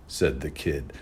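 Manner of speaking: plain reading voice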